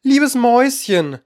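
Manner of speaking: high, coaxing sweet call; tender calling. A narrator's reading. Alice calls the fleeing mouse back, tenderly. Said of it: "rief ihr daher zärtlich nach".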